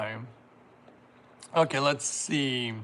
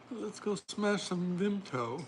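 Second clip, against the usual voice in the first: high, slow, soft mock-British voice; playful British attempt, pitched up and slow; attempted British accent; 5 semitones higher, 6 dB quieter, slower than usual. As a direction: put on a bad British accent, drawn out. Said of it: "I can't do my very British accent".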